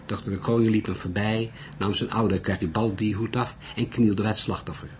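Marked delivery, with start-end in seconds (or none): none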